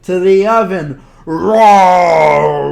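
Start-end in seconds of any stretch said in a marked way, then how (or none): 1.2-2.7 loud drawn-out roar; loud hammed-up bear roar. Bear roars in story.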